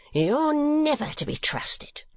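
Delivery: high, drawn-out wail of anger; high-pitched scolding scream; screamed accusation. A narrator's reading. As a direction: screech it slowly as the wronged wife. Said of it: "screamed the woman".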